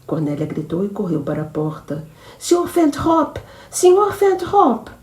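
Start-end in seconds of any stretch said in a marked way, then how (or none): 2.4-4.9 much higher, loud, slow calling for help; desperate shouting. Acted. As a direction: cry out for him across the ship. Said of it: "gritou Cornélia". (gritando por socorro, agudo e alto)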